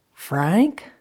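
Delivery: tentative call with a high rising end. Acted. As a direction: call his name through a door, voice rising. Calling through the closed door.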